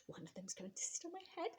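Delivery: sly unvoiced whisper; whispered thought. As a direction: crocodile plotting silently. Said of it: "he thought".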